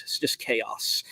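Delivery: soft whispered aside, slow. Real, not acted. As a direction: breathe it out like a secret. Host jokes about his show.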